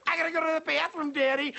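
put-on high, fast whining child; whiny kid voice. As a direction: beg like a kid in the back seat. Kids yelling from back seat.

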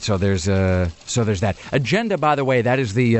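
ordinary delivery

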